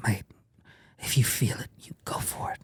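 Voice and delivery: imitating a friend's soft whisper; hushed earnest advice. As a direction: whisper solemn encouragement to a mate.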